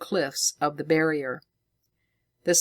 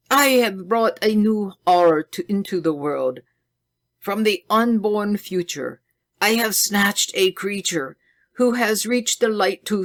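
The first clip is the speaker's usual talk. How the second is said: 1 passionate confession; 5 semitones higher, 4 dB louder than usual. A narrator's reading. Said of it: "turned passionately to Northwood".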